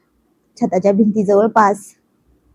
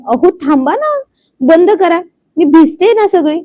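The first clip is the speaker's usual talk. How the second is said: high, louder squeal of stop it; playful protest; 7 semitones higher, 4 dB louder than usual. A narrator's reading. Wife squeals at husband spraying her with the hose, high and louder. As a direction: cry out half-laughing to make him stop.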